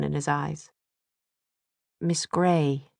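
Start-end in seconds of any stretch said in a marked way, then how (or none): none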